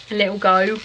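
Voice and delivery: light Cockney twang on "a little go"; Cockney accent. Acted. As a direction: slip briefly into a Cockney accent. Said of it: "Don't know why I went Cockney then".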